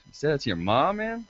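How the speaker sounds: ordinary talk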